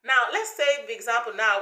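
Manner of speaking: ordinary talk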